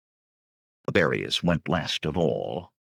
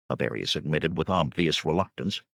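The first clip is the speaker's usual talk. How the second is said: ordinary delivery, nothing marked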